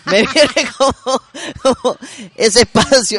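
risa aguda y fuerte mientras habla; hablando entre risas. Real, not acted. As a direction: se le escapa la risa contando. Se ríe recordando su rabia. (habla riendo al recordar las llamadas al call center)

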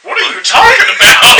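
high, loud baffled question; mock startled. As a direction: bark it out in disbelief. Startled retort.

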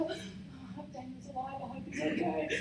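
very soft, low worried murmur; hushed, anxious hoping to oneself; imagined worried king. Acted. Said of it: "You imagine him".

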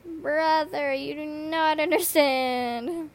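loud, slow, drawn-out exasperation; exasperated outburst. Retold or imitated. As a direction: lay it on thick: you don't get it. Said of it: "and I'm like".

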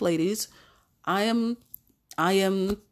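ordinary delivery

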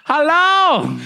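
squeaky shopkeeper; high shop owner voice. Acted. Shop owner answering a customer's phone call, high caricature voice.

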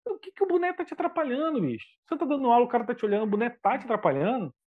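high, scoffing disbelief at the rule; incredulous mockery. Questioning a colleague over a cap, in high scoffing disbelief. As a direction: pitch it up in mock outrage. Mocking the strict teacher.

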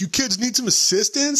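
high, sing-song cop voice; goofy cop offering help; mock cop line. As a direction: ham up the clueless cop. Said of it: "the cop pulls up and he's just like".

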